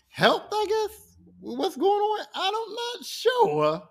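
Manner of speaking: very high, drawn-out hedge; doubtful hedging. Real, not acted. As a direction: squeak it out slowly, unsure.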